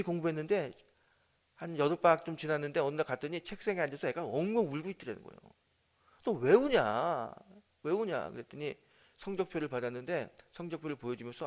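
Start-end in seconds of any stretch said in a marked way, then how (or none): 6.2-8.3 louder, very slow, drawn-out question; slow worried asking. Retold or imitated. Father asking his crying daughter why she cries. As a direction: draw it out, concerned for her.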